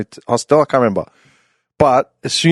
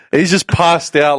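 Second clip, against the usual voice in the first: normal talking voice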